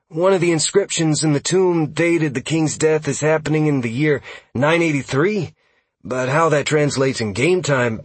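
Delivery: eager elf scout. Acted. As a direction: explain it like an eager lore nerd. Narrator voices elf scout Huxley.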